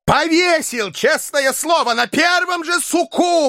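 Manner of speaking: high, slow, vehement threat; furious exclamation. A narrator's reading. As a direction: spit out the threat. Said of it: "воскликнул ... яростно".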